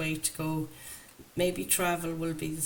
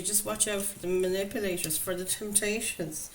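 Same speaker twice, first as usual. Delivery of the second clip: usual speaking voice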